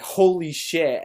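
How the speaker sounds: slow, loud startled curse; mock startled dad. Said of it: "My dad was like".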